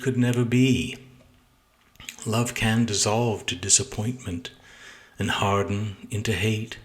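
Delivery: measured, deliberate sonnet recital with pauses between lines; solemn poem read-aloud; poetry reading. Acted. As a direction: recite your poem aloud, weighing each phrase. Reads own sonnet aloud.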